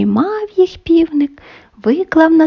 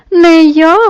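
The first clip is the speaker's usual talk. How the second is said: loud, higher refusal called back; calling out; 4 semitones higher, 7 dB louder than usual. A narrator's reading. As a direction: call out your refusal, raised in pitch. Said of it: "гукає Верть". (гукає голосно й вище)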